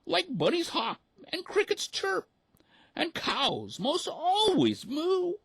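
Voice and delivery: shocked mother duck; squeaky duck mother aghast; gasping mother duck. A narrator's reading. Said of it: "she gasped".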